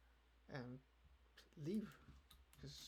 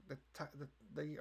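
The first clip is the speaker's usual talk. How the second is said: normal talking voice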